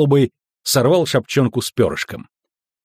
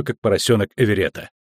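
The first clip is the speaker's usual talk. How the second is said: ordinary delivery, nothing marked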